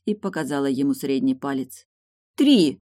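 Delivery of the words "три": high, loud single word; loud and distinct. A narrator's reading. Tilda turns back to Morton, loud and distinct. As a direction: punch it out loudly and clearly. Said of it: "произнесла громко и чётко".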